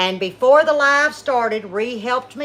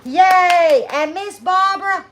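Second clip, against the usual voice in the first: excited cheer; 7 semitones higher, 4 dB louder than usual. Real, not acted. Cheering for the winners.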